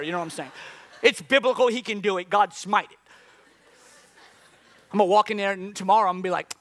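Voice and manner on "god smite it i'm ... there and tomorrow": ordinary talk, nothing marked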